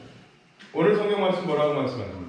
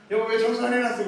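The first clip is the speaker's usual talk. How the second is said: higher, mild mock reproach; complaining husband; 8 semitones higher, faster than usual. Pastor voicing a husband blaming his wife for the messy house. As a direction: nag lightly about the mess. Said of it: "남편이 뭐라 그래요".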